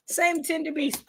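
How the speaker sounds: usual speaking voice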